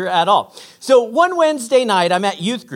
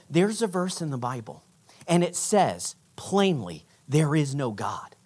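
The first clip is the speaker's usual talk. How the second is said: hushed revelation; 7 semitones lower, 8 dB quieter than usual. Retold or imitated. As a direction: share it like a grave secret. Friend reveals a troubling secret verse.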